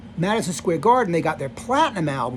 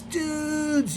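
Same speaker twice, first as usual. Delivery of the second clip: high-pitched mock rocker greeting; imitating Tommy; 11 semitones higher, 5 dB quieter than usual. Storyteller imitating Tommy spotting his friends, high mock greeting. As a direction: pitch way up to play Tommy's excited hello.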